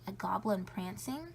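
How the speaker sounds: usual speaking voice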